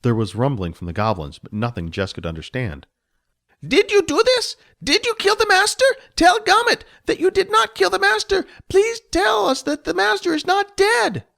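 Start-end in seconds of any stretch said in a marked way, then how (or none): 3.7-11.2 high, loud goblin voice begging for denial; a frantic goblin who cannot believe it; pleading goblin. Acted. Gomet begs Jess to say the master lives.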